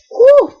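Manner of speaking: very high, loud whew; shocked whew. Real, not acted. Reacting to Beckett resigning, a stunned high whew. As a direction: blow out in disbelief.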